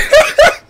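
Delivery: very high squealing laugh; high laugh burst. Real, not acted. Laughing uncontrollably at the joke. Cracking up.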